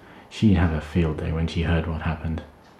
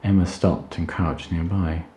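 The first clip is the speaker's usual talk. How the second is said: ordinary delivery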